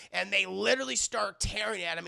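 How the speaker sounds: ordinary talk, nothing marked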